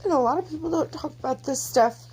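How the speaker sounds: high, choked-up voice; real upset, struggling to go on; tearful. Real, not acted. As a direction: speak up through tears, pitched high. Composing self after crying.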